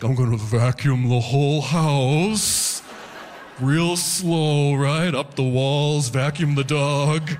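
stoned. Retold or imitated. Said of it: "take a couple edibles and just be like".

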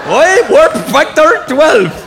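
high, loud and stretched out, mock Scottish; slow garbled wail in a bad Scottish accent; Scotty from Star Trek. Star Trek engineer questioning warp factor twelve, slow high mock Scottish. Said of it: "Scotty in Star Trek".

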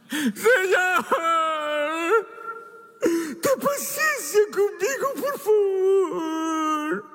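high tearful plea, cracking into a cry; sobbing plea for mercy; begging servant. Acted. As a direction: beg like a desperate debtor.

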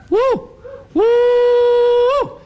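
high hooting woo, loud and rising; bunyip mating call. Acted. Old bushman shows the mating call of the wild bunyip, hooting loud and high. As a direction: hoot it out like a wild creature calling its mate. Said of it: "you call again".

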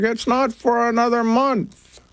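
high, drawn-out mom impression; imitated mom. Mom correcting her child about Thanksgiving, imitated high voice.

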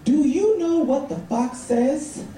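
put-on high, slow child's question; niece. Niece quizzing her uncle at the party, slow and high. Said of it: "And she says".

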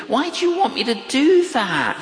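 high-pitched mock complaint; whining protest. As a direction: plead as if put upon. Acting out his complaint to God.

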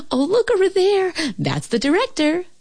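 very high, excited squeal of a voice; excited little dog. A narrator's reading. Sluggo spots the director, excited little dog. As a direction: point it out in high, giggly excitement.

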